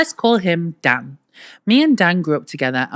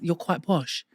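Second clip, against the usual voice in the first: ordinary delivery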